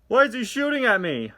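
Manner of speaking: high, loud frightened cry; mock panic. McQueen fleeing the sheriff, high and loud alarm.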